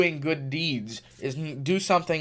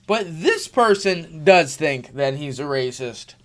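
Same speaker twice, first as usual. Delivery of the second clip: ordinary talk, nothing marked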